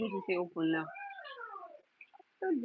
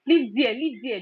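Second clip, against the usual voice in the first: loud, raised scolding; sharp warning; 3 semitones higher, 9 dB louder than usual. Real, not acted. Scolding baby near power bank.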